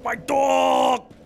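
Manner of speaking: loud, high anguished cry of "my dog"; Big Smoke yelling. Retold or imitated. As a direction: wail it like the game character losing his dog. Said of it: "it's all Big Smoke going".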